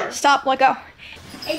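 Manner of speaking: ordinary delivery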